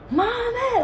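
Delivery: high-pitched mock cry, held back in volume; retold scream. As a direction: imitate a child wailing for mom. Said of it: "and I'm screaming".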